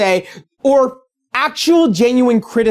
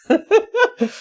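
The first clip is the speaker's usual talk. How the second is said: high burst of laughter; 11 semitones higher than usual. Real, not acted. Laughs at own joke.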